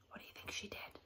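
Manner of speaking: soft whispered question. Real, not acted. Teacher asking the class to guess, whisper. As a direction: lean in and ask in a hush. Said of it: "teacher asks class in whisper".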